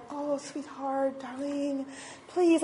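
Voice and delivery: slow, high, mock-tender pleading; syrupy sweet-talk. Speaker showing how she doesn't talk to people, sugary coaxing. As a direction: coo it in exaggerated sweetness. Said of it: "I'm not like".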